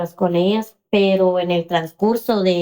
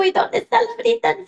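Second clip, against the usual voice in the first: very high, quick question; retold worry; 14 semitones higher, faster than usual. Mother asking where the younger sister is, anxious. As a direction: re-enact an anxious parent's urgent question. Worried mother asks after other daughter.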